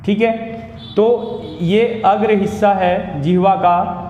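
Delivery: usual speaking voice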